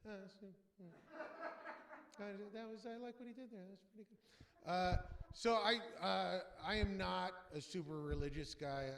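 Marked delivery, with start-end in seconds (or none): 0.1-4.7 barely audible, grudging praise; quiet, reluctant half-laugh; grudging comedian mutter. Retold or imitated. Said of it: "The most you can hope for from a comedian".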